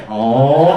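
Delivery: loud, low open-mouthed "ohh"; popsicle-gobbling "oh". Acted. Breaking off conversation to gobble a popsicle, mock groan.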